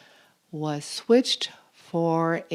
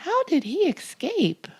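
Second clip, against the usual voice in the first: surprised question; 10 semitones higher, faster than usual. Acted. Wondering aloud.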